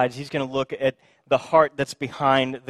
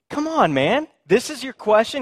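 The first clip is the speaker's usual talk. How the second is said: higher, louder eye-rolling "Come on, man"; exasperated teen; 9 semitones higher, 5 dB louder than usual. Retold or imitated. Jesus pictured as a teenager rolling his eyes, exasperated. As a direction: play Jesus as a fed-up teenager. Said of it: "If he was a teenager".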